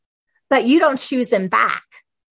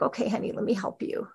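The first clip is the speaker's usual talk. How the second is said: very soft reassuring hush; tender hush; 2 semitones lower, 11 dB quieter, faster than usual. Acted. God reassuring the ashamed believer, very soft. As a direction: hush it, soothing a child.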